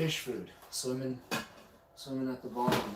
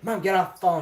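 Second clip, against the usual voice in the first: raised, higher urgent call; calling out to mom; 6 semitones higher, 8 dB louder than usual. Retold or imitated. Teen telling mom to get off the phone, urgent call. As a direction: call over to mom to hang up.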